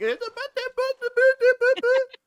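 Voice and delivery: very high, loud gibberish noises; bad kid-impression babble. Co-host imitates the skit actor's bad kid voice, high babble. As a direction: badly fake a child with squeaky nonsense syllables. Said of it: "I probably can't do a good kid impression".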